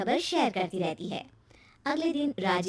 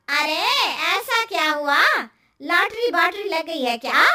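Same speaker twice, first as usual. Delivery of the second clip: loud, nosy neighbour aunty; lightly voiced aunty, surprised and prying; curious neighbour aunty; 3 semitones higher, 6 dB louder than usual. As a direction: call out a teasing, astonished question.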